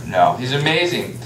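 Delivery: plain reading voice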